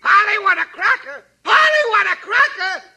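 high shouted parrot call; parrot squawk. Acted.

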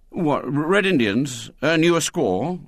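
stammered start, then keener; hesitant boy warming to an idea; William, interested. A narrator's reading. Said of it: "with a gleam of interest".

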